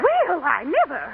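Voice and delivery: slow, affronted "well, I never"; scandalized huff. Acted. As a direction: draw it out, deeply offended. Teacher insulted by mother.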